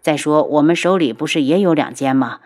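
usual speaking voice

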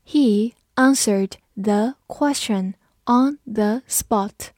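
slow, word-by-word model reading; slow read-along. Shown as a demonstration. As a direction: read it slowly with gaps between words for learners. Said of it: "跟着我慢读一遍". (慢速逐词跟读)

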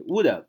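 "would have" said as "would-uv"; linked, weak-form "would have"; reduced have. Shown as a demonstration. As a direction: stress WOULD and drop the "h" of "have". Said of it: "要注意这个读音".

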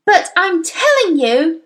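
high, slow, loud insistence; drawn-out loud objection; shouted protest. A narrator's reading. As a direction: shout back, refusing to give in. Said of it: "Matilda shouted".

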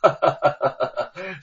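open, rising burst of laughter; big laugh. Acted. Wu Cheng'en laughing at his friend's teasing, big laugh. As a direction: laugh out loud at the joke. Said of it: "吴承恩闻言大笑".

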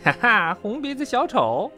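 high, laughing taunt; high-pitched ha-ha mockery; playful teasing. A narrator's reading. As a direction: tease your friend merrily. Friend teases about red nose.

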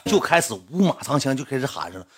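usual speaking voice

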